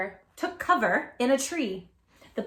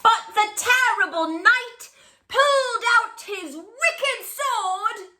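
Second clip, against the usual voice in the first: higher and louder, villain-tale drama; dramatic storyteller; 10 semitones higher, 6 dB louder, slower than usual. Acted. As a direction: build it up like a thrilling bedtime tale. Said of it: "read aloud in a booming voice".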